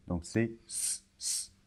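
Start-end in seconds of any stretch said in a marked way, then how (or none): 1.2-1.5 S as a soft hissed s sound with no voice; "S" said as a long unvoiced hiss; voiceless S. Shown as a demonstration. Teacher showing the voiceless S to a learner. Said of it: "d'un S, euh, sourd".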